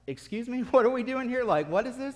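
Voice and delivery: high-pitched incredulous reply; baffled. Retold or imitated. Retold baffled reply to cow baiting.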